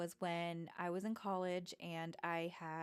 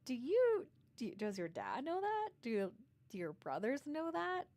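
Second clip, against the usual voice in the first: shocked; 6 semitones higher, 4 dB louder than usual. Retold or imitated. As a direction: voice jumps high and loud in disbelief. Shocked questions to child.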